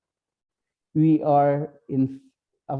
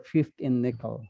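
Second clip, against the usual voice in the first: ordinary delivery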